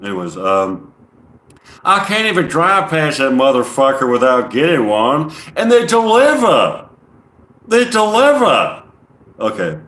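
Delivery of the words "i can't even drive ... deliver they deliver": loud, high-pitched Southern send-up; put-on Southern accent. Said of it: "I kinda like doing the Southern accents".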